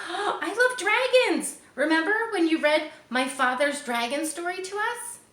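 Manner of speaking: put-on excited girl. Song Lee voiced excitedly.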